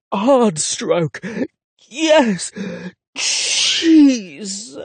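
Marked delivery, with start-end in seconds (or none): 1.9-4.8 loud breathless cries of pleasure; ecstatic cries. Acted. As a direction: cry out at the peak of passion. Climax cries during sex.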